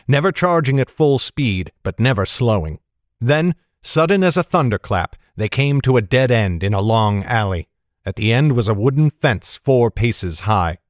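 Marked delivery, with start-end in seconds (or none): none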